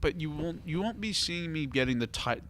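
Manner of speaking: normal talking voice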